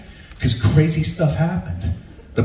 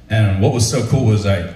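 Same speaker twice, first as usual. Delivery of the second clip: ordinary talk, nothing marked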